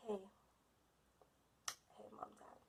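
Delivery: near-silent greeting, holding back tears; a breathy whisper on the verge of crying; tearful whisper. Retold or imitated. Teary daughter greeting parents on the patio, whispered. My eyes are, like, welling with tears.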